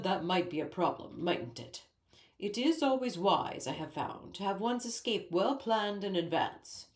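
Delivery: a lightly voiced pompous king, musing; dignified king. A narrator's reading. King muses to Ellie about planning an escape, dignified. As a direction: ponder escape plans with royal gravity.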